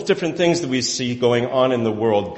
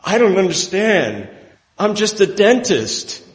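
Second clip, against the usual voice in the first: bewildered Alan Arkin; 8 semitones higher, 4 dB louder than usual. Retold or imitated. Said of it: "And Alan Arkin says".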